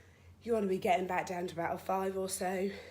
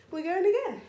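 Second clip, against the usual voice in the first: higher and louder, pumping up the class; rallying call; 11 semitones higher, 10 dB louder than usual. Real, not acted. Fitness instructor to home viewers, loud rallying call. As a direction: call it out to get everyone moving.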